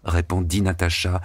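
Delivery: usual speaking voice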